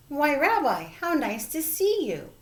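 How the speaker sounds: exclaiming. A narrator's reading. Grandmother greeting the rabbi at the door, exclaiming. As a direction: welcome the rabbi with a happy exclamation. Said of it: "Baba Brena exclaimed".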